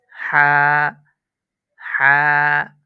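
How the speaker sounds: হা breathed from the throat, held one beat; হা, loud throaty one-beat stretch; one-alif ha. Shown as a demonstration. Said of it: "এটা এক আলিফ টান হবে". (হা গলার মাঝ থেকে এক আলিফ টানে)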